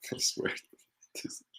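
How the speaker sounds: quiet breathy laugh on the words; stifle the giggle as you say it; laughing softly. Real, not acted. Medium trying to calm down, softly laughing. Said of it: "I have to compose myself here".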